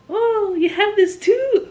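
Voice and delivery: very high, loud, slow squeal; high mocking quote. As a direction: squeal it like a surprised girl. Mocking partner's question, very high.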